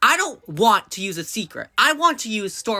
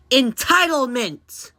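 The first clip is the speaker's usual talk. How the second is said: emphatic outburst; 7 semitones higher, 4 dB louder, much slower than usual. Real, not acted. Punchline to his own question.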